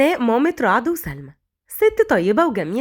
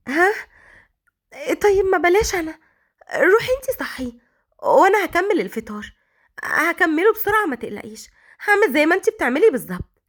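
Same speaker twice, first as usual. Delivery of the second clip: higher, pleading and timid; frightened; 5 semitones higher than usual. A narrator's reading. Scared maid begging her mother-in-law not to go, timid and high. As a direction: beg nervously to be spared. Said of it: "ملاك بخوف".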